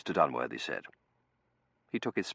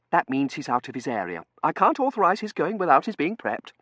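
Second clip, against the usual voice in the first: high, loud objection; officious Seraphim; 10 semitones higher, 10 dB louder than usual. Acted. Seraphim refusing Dunworthy's request, high officious voice. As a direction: refuse on procedure in a raised, high voice.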